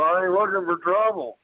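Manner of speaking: high-pitched, insistent retold warning. Retold warning to creature in tree.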